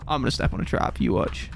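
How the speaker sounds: hushed aside to chat; whisper. Real, not acted. Streamer muttering to chat, whispered. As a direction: breathe it out, resigned.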